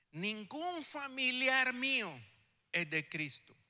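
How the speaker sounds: high, slow and deliberate; solemn declaration. Retold or imitated. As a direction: lift the voice and draw it out solemnly. Quoting what he told the pastor.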